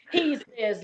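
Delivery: ordinary delivery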